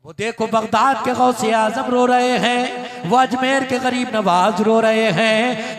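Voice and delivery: loud, driving preacher's litany; impassioned preaching. Acted. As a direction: build the list with rising fervour. Fervent sermon list of weeping.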